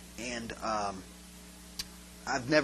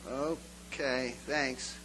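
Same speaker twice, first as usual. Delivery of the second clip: deflated acceptance; 5 semitones lower, 8 dB quieter, much slower than usual. Retold or imitated. Deflated reply to the usher.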